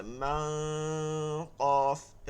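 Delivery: "মা" held as a thick nasal hum into "কফ"; heavy ghunna. Shown as a demonstration. Teacher showing how ghunna goes, "মা" held long and nasal. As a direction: draw "মা" out through the nose. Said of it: "এরকম গুন্না হবে".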